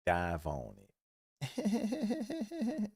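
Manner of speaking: soft, slow heads-up, laughing at the end; quiet tip-off. Retold or imitated. Teammate Derek quietly tipping Andre off, slow and soft. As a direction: murmur it like a secret warning. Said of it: "Derek was like".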